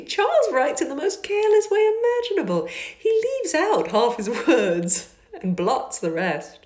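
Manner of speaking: high, loud, teasing scorn; mocking high lady's cry. A narrator's reading. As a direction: laugh at her brother's carelessness. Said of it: "cried Miss Bingley".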